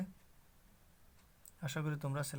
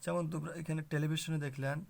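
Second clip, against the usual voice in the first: normal talking voice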